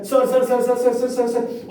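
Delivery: high, repeated calls of "sir"; fussing security guard. Acted. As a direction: fawn over the star in a high chatter. Security guard fussing over star. (Guard की ऊँची, हड़बड़ाई पुकार)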